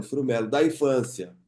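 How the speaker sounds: ordinary delivery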